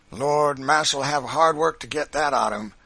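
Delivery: loud, high Southern dialect voice; henchman's exclamation. A narrator's reading. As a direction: exclaim emphatically to the boss. Henchman answers master in dialect.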